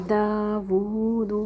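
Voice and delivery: داوود with the long a and oo held two beats; elongated recitation. Shown as a demonstration. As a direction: stretch both madds slowly. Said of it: "ٹو حرکہ اس کو بھی کھینچنا ہے". (دو حرکہ کھینچ کر)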